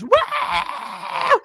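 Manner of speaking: put-on high-pitched kid's rebel yell; long, high whooping cry. Little girl celebrating the home run, high rebel yell. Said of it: "you could hear her rebel yell".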